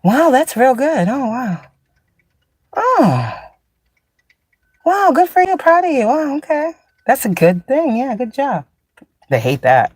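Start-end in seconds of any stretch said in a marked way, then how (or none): 0.0-8.7 high, mock-impressed wow; sarcastic praise. Sarcastically praising someone playing tricks. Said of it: "you're like".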